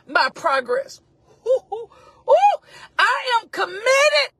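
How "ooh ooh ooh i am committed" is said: high, loud preacher's whoop into a cry; impassioned outcry. Real, not acted. Preacher whooping to the congregation, impassioned cry. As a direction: cry it out with fervor.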